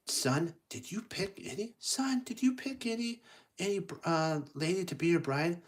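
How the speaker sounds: high-pitched eager parent voice; king and queen asking. Acted. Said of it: "the father and his mother".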